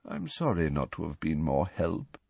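soft, low apologetic voice; gently. Acted. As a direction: apologise tenderly. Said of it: "said Nick gently".

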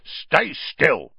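gruff grunt. A narrator's reading. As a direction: bark it like a grumpy dwarf. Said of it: "grunted the dwarf".

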